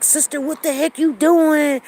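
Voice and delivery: angry men in line jeering at a mixed couple, loud; angry men heckling. Retold or imitated. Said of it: "They was like".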